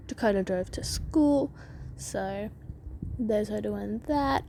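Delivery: ordinary talk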